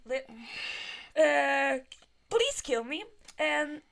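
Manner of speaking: ordinary delivery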